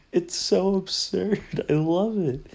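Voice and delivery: high, loud, laughing delight. Real, not acted. Vlogger gushing about the absurd scene, laughing high and loud.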